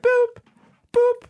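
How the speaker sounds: high, evenly spaced "boop"; very high electronic-style boop; imitated monitor beep. It's like they show your family.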